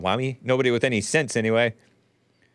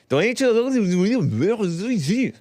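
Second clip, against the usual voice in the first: high, slow, stretched mock French take; mock French-sounding; 8 semitones higher, slower than usual. Host's second try at the Irish title comes out mock French. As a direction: sing it out like a fake Frenchman. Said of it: "No, that sounds French".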